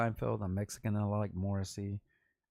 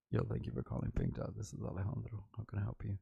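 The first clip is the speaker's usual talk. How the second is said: phone operator voice; 6 dB quieter, faster than usual. Retold or imitated. Pink Dot operator greeting callers, soft phone voice. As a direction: answer calls in a hushed, smooth voice. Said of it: "I would be like".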